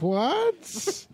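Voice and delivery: high, incredulous mock "what"; retold disbelief. Retold stunned reaction of characters.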